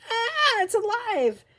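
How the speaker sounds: very high, loud shriek of comic terror; piercing high-pitched horrified scream; lady's scream. A narrator's reading. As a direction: shriek in panicked shock. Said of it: "The lady screamed".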